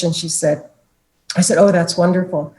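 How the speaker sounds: ordinary talk, nothing marked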